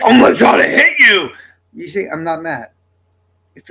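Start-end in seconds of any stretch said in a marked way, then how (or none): 0.0-1.3 loud, high mock-angry yell; a put-on screamed outburst, not really mad; demo scary voice. Shown as a demonstration. Streamer showing chat the voice that gets mistaken for anger, loud mock rage. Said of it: "I do the little thing with the boys".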